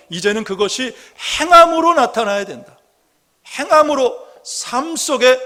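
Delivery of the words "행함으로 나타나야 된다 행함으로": 행함으로 punched high and loud; emphatic preaching. Real, not acted. Preacher insists confession must show in deeds, emphatic stress on 행함으로. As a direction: hit 행함으로 hard, with a jump in pitch.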